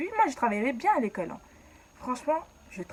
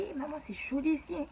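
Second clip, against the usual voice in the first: put-on higher, kid-like quoted self; child's excitement; 8 semitones higher than usual. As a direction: sound like a thrilled little girl. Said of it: "Je dis".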